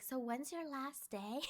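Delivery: hushed, slow question meant to be overheard; imitated loud whisper. Coworker asking about her last day, mild loud whisper. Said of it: "she said it kinda loud".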